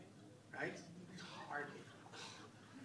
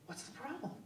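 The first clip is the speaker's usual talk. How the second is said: polite clerk; 10 semitones higher, 5 dB quieter than usual. Retold or imitated.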